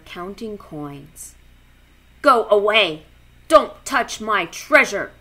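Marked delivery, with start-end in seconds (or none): 2.2-5.1 loud, grumpy lobster growl; lobster voice with claw clacks. A narrator's reading. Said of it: "the lobster growled".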